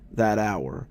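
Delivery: ordinary delivery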